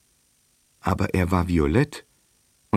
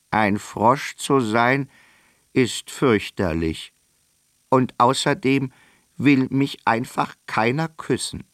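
whiny prince-turned-frog voice; 6 semitones higher than usual. A narrator's reading. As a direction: play a sorry little frog begging to be kissed. Frog speaks in story.

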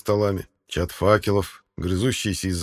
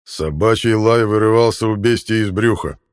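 booming warrior king; 2 semitones higher, 7 dB louder than usual. A narrator's reading. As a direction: boom it out, rage barely contained. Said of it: "ответил король". (громогласный король, сдерживающий ярость)

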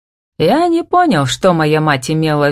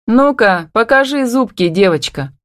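higher, understated taunting call; mild shout; 6 semitones higher than usual. A narrator's reading. Woman challenging the younger one, mildly shouted taunt. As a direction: call her out, lightly shouted. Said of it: "прокричала она".

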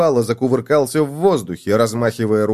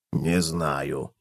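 low, soft reply; puzzled cockroach; 8 semitones lower, 8 dB quieter than usual. A narrator's reading. Cockroach answers gopher's riddle, unknowing. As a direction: answer quietly in a deep pitch. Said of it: "ответил таракан".

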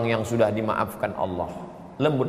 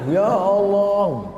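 high, long-held wail to God; stretched, raised tearful invocation; imitated crying plea; 7 semitones higher, 4 dB louder, much slower than usual. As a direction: cry out the prayer in mock weeping. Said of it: "ketakutan mau nangis".

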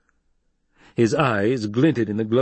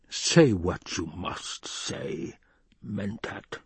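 menacing permission; 4 dB quieter, much slower than usual. Acted. Baron lets his Mentat speak, slow menace. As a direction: stretch each word, drop away on the last.

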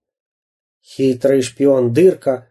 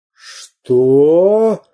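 mildly outraged questioning cry; 3 semitones higher than usual. A narrator's reading. Pirate captain reacts to an insult, outraged. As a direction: draw out an indignant question. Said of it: "позеленел от ярости".

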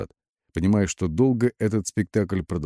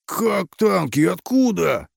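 high, startled squealing questions; panicked squeal; 12 semitones higher, 3 dB louder, slower than usual. A narrator's reading. Officer hears of approaching tanks, squealing. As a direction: squeal it like a pig. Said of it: "завизжал свиньёй военный".

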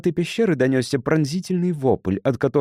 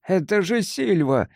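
higher-pitched startled exclamation; exclaiming; 7 semitones higher than usual. A narrator's reading. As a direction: exclaim it in sudden recognition. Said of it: "воскликнул Гарсия". (восклицание)

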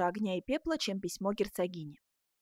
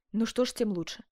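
raised, forced acceptance; feigned self-control; 2 semitones higher, 4 dB louder than usual. A narrator's reading. Duchess pretending to overcome her love, forced composure. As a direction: act as if mastering your feelings. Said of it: "делая вид, будто превозмогает своё чувство".

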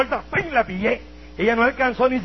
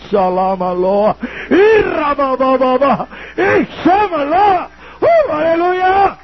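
loud, much higher, slow ecstatic chant; speaking in tongues; 9 semitones higher, 6 dB louder, much slower than usual. Retold or imitated. Preacher reliving his baptism in the Spirit, ecstatic tongues. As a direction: pour out tongues in rising rapture.

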